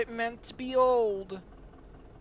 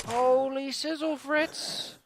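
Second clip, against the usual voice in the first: mock surprise; 8 semitones higher, much slower than usual. Exaggerated exclamation, high.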